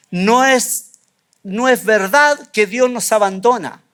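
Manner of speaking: ordinary talk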